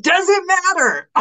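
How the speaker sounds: an exasperated mock challenge; incredulous. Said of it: "And you're like".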